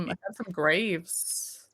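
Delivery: I found some graves said slow and hushed; ominous. Shown as a demonstration. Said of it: "slow quiet repeat".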